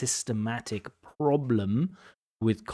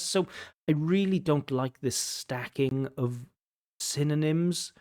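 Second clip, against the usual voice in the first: ordinary talk, nothing marked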